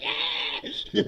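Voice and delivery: hoarse-free imitation of a cheering crowd; mock crowd cheer. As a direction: roar along like the convention crowd.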